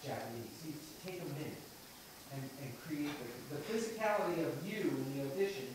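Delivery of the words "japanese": low, quiet wounded delivery; wounded character. Shown as a demonstration. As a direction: let the word out low and soft, as if stabbed. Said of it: "you're stabbed in the monologue".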